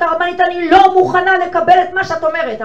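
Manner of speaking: high, loud defiant shout; defiant objection. Retold or imitated. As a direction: shout your refusal from the audience. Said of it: "מישהי אומרת".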